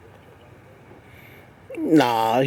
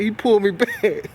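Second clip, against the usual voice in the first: ordinary delivery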